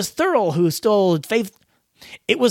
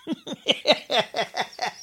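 soft, higher-pitched laughing; 4 semitones higher, 6 dB quieter than usual. Real, not acted. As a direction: laugh quietly at your own joke. Laughs alone after own joke.

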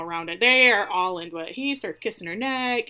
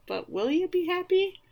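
mock pointed question; 10 semitones higher than usual. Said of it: "And he's like".

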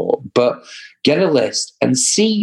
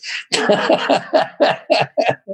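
a big high burst of laughter; hearty laugh; 13 semitones higher than usual. Real, not acted. Laughs at partner's buzzword.

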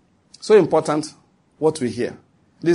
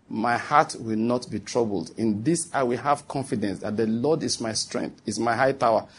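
calm prayer; 2 semitones lower, 5 dB quieter than usual. Acted. Reclined passenger praying amid smoke, calm and confident. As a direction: pray it quietly, with settled trust.

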